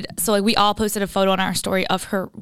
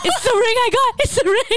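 high-pitched mock panic, much higher than usual; retold sweating panic; 13 semitones higher than usual. Friend acts out boyfriend realizing it's his ring, high mock panic. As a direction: imitate a boyfriend freaking out. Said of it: "He's, like, sweating".

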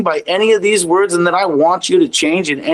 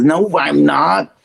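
a slow, stubborn denial from the ego; mock ego; much slower than usual. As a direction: let the ego deny it, slowly and stubbornly. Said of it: "And the ego says".

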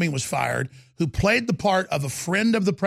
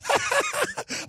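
burst of laughter; 4 dB quieter than usual. Real, not acted. Laughs at his own skit.

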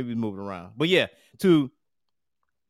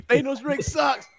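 high teasing voice; 11 semitones higher, slower than usual. Pretend. Teasing name, high slow.

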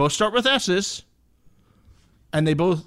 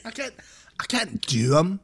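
imitating Kyle; 3 semitones higher than usual. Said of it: "The way he does".

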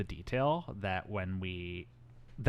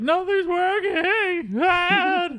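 high, loud, drawn-out mock whine; Fraser; 5 dB louder, much slower than usual. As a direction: wail it slowly like a whiny son. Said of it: "Fraser's just like".

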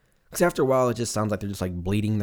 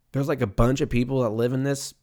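ordinary delivery

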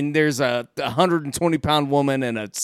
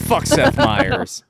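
mock grudge; 8 semitones higher, much slower than usual. Mock grudge against Seth Meyers.

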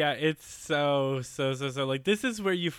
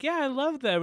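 high, chirped mock enthusiasm; innocently keen, pitched way up; eager reply; 11 semitones higher than usual. Host voicing Yuri answering Victor about the bowls, eager.

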